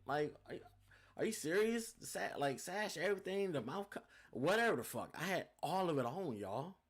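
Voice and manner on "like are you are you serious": pitched up, louder disbelief; incredulous. Retold or imitated. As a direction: ask it as if you can't believe it. Indignant disbelief at losing.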